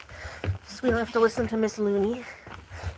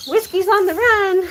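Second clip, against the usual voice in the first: excited call; 10 semitones higher, 7 dB louder than usual. Real, not acted. Goat escaping, she laughs.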